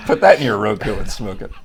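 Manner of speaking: laughing. Real, not acted. Joke punchline with laughter.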